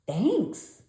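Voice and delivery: high loud thanks; cried. A narrator's reading. Froggy thanks the librarian, high and loud. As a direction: thank her with delight. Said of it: "cried Froggy".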